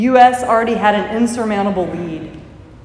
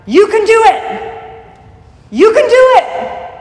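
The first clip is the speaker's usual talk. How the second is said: high, loud coach's shout; loud, high-pitched rallying cry; shouted encouragement; 13 semitones higher, 6 dB louder than usual. Acted. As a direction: yell it urging an athlete on from afar. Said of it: "while he shouted back".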